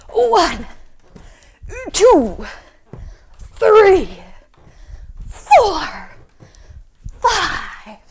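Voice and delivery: high, loud count bursting out with each jump; big exclaimed count, falling on each number; effortful jump count. Pretend. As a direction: call each number as you leap up. Counting out big frog jumps.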